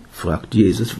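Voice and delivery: usual speaking voice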